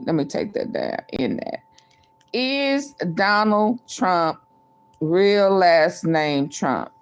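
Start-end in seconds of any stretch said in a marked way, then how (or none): 2.3-6.9 spelled-out slow read for typing; slow, careful dictation of a poll; dictating slowly. Acted. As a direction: say each word slowly as you type it.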